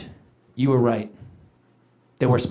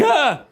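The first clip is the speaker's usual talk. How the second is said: put-on kid's shriek; 9 semitones higher than usual. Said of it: "she's like".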